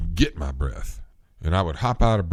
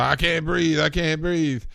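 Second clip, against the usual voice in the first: mocking; 10 semitones higher than usual. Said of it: "I know a cop will say".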